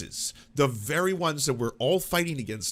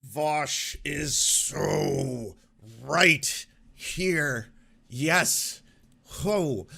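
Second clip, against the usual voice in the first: drawn-out call; much slower than usual. Real, not acted. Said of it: "for the people in the back".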